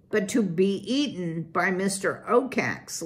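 usual speaking voice

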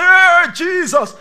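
high, very loud cry; mock alarmed shout. Congregant acted reacting to the fire, loud mock-alarmed shout. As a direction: cry out as if the fire just hit.

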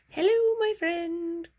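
very high, loud, sing-song greeting; friendly tree monster voice. Pretend. Happy tree monster greeting a friend, high and sing-song. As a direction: voice the happy monster welcoming you. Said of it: "She's saying".

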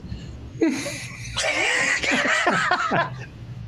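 a long high breathy laugh; high laughter. Real, not acted. Recalling Norm's ESPYs joke, he breaks into high laughter. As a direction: crack up at the joke.

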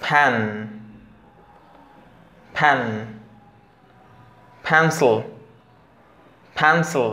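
টাইম, টেন্স, পেন্সিল with strong aspirated t and p; breathy puff on each t, p and k; aspirated English consonants. Shown as a demonstration. Teacher drilling aspirated t, p, k to students. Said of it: "এবার চলুন মূল ট্রিক্সে যাওয়া যাক".

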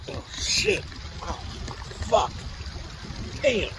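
startled curse under breath, hushed and breathless; sudden pain. Real, not acted. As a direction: caught off guard by a sharp hurt. Sudden pain while recording.

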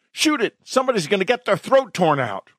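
high, hurried alarm; panicked cry. A narrator's reading. Onlooker demands police shoot the wolf, high and panicked. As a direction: urge it in a rush of panic.

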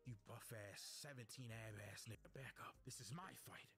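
low-pitched put-on old man's indignation; indignant in a deep mock voice; deep, mock-outraged old master; angry old fighter.

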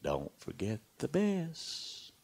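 slow, soft echoing voice from inside the mountain; mysterious voice. A narrator's reading. The mountain's voice warning the shepherd boy, slow and soft. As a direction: let the words hang like a quiet omen. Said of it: "and the voice said".

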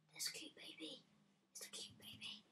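whispering. Real, not acted. Sees a baby mob.

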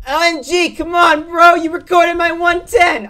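high, loud whiny complaint; imitating teammate. Teammate Banana complaining about being recorded, high whiny shout. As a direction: whine at him like the annoyed teammate. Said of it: "He's like this".

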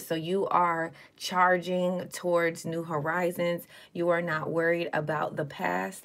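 ordinary talk, nothing marked

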